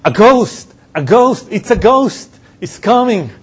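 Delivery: panicked high cries of a ghost sighting; frightened screaming. Retold or imitated. Disciples in the boat screaming in panic at a ghost. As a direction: shriek it like terrified fishermen. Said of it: "where they have them screaming".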